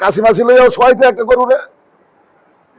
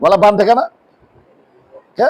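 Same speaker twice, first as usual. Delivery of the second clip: ordinary talk, nothing marked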